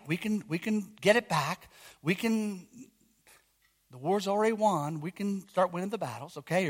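quiet urging, calling the flock back in. Real, not acted.

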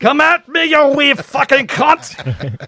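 very high, loud Scottish yell; hammed-up drunk Scot spoiling for a fight; drunken Scottish brogue. Joking that the brogue is just drunkenness, shouted Scottish impression.

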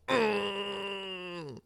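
high, drawn-out imitated moan; convulsing groan. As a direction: mimic a shaky addict's long groan. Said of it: "mildly convulsing".

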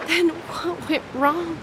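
tearful, slow question; crying. Acted. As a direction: ask through tears. Breaking down.

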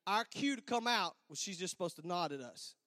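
ordinary talk

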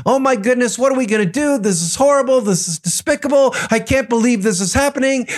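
fretting in a mock high-pitched panic; freaking out. Disciples in a storm-tossed boat, freaking out. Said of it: "They're freaking out".

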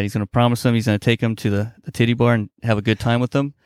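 ordinary delivery

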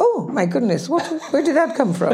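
giggling mock shock, pitch rising; laughing exclamation. Guest retelling a mishap to the host, laughing in mock surprise. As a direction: act out her own startled surprise through laughter. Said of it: "I go".

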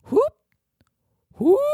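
rising hooting whoop; lemur call whoop. Retold or imitated. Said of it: "the man demonstrated a call".

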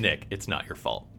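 low, soft, earnest movie-therapist line; mock therapist. Friend quoting the movie scene, soft and low.

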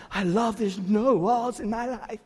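slow dreamy mock hippie voice. Pastor mocking hippie love for the congregation. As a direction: lightly play a spaced-out hippie. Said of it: "like this kind of hippie love".

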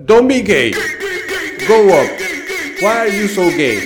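put-on loud, high shout in accented English; climbing instructor shouting up at the student; instructor yelling. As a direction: holler up at the scared climber.

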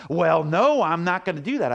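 stubborn ranger refusal. Retold or imitated. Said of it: "And I said".

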